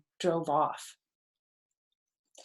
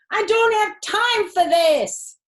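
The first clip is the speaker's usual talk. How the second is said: high, loud and slow exasperation; frustrated outcry; 12 semitones higher, 9 dB louder than usual. A narrator's reading. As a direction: wail it, at the end of your rope. Fox overwhelmed by wild kids.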